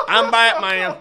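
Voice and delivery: laughing Southern Batman. Retold or imitated. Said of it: "sounding like a Southern Batman".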